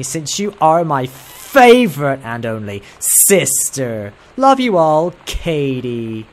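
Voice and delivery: put-on overdone amateur American accent, reading aloud. Sister reads out her postcard from Paris, overdone American accent. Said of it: "I never said I could do an American accent".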